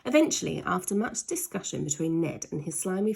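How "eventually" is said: plain reading voice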